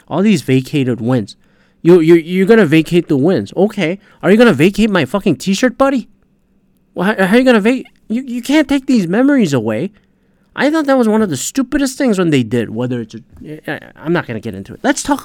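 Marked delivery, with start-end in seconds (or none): 1.8-12.0 worked-up rant. Real, not acted. Ranting about vacated wins.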